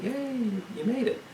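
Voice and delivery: high-pitched, softly voiced cheer; mock parental cheer. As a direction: cheer a toddler on without raising the volume. Parent cheering child who comes.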